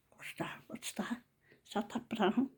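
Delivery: very soft near-whisper; murmured under the breath; retold muttering.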